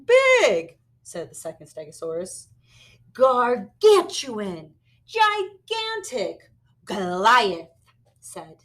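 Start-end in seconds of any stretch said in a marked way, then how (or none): 0.0-0.8 much higher, loud "Big"; high echo. Acted. Second dinosaur echoes higher. 3.1-7.7 slow, loud, relishing each word; savoured words. Acted. Dinosaur savours big words.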